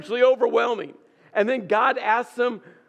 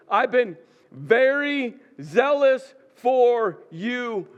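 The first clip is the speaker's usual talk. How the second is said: Elijah throwing his zeal back at God; pointedly emphatic; 3 semitones higher, slower than usual. Acted. Elijah's annoyed answer to God, acted.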